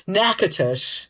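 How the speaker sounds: Natchitoches said slowly as NACK-uh-tush; Natchitoches carefully pronounced as nack-uh-tish; careful pronunciation. Shown as a demonstration. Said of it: "however it's read".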